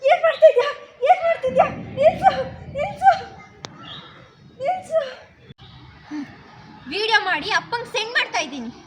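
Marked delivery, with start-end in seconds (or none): 0.1-5.0 alarmed shout. Acted. Woman caught on video shouts at the girl to stop. Catches someone filming her.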